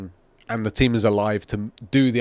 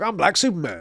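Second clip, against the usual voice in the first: mock tough hero; 7 semitones higher than usual. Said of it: "They're like".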